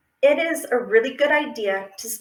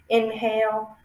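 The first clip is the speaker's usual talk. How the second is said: usual speaking voice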